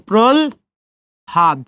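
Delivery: প্রল ... হাদ split as "prol", pause, "had"; syllable-by-syllable pronunciation. Shown as a demonstration. Teacher answering how to pronounce it, syllable-by-syllable pronunciation. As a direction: sound the l first, then হাদ with a clear h. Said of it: "তাহলে কি উচ্চারণ করবেন?".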